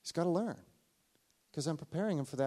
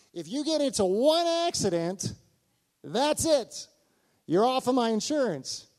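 louder, higher, laying down the law; firm fatherly ultimatum, voice raised; stern warning; 9 semitones higher, 6 dB louder than usual. Retold or imitated. Dad laying down rule to son.

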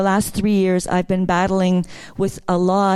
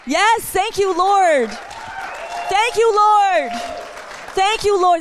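shouting praise; 10 semitones higher, slower than usual. Real, not acted. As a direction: lift it up in ecstatic praise. Spontaneous praise to God.

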